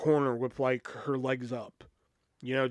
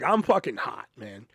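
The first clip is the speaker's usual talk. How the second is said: ordinary talk, nothing marked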